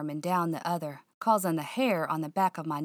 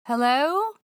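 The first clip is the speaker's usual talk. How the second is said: high, louder call into the empty stairwell; uneasy hello; nervous call; 8 semitones higher, 6 dB louder than usual. Acted. Calling up dark stairwell.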